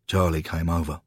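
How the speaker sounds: usual speaking voice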